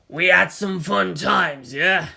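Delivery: slow, otherworldly voice in the head; alien inner voice. Acted. The Eldar speaking inside Shinji's mind, slow and otherworldly. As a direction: drawl it like a presence inside his mind.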